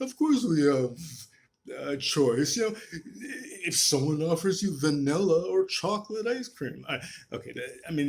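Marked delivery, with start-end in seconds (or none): 0.0-6.8 mock Frank Turek. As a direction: ham up a smug apologist talking down. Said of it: "he doesn't talk like that".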